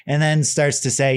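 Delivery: ordinary delivery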